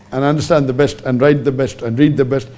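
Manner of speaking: ordinary talk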